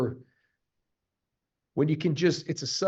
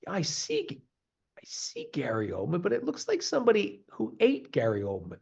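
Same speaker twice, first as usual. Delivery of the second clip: a hint of an amazed viewer, higher on the first and last words; mock disbelief at the screen; 7 semitones higher than usual. A viewer describing Oldman's transformation, lightly amazed. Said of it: "and you're like".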